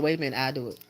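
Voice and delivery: normal talking voice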